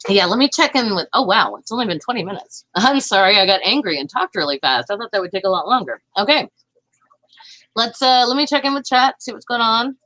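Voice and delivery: normal talking voice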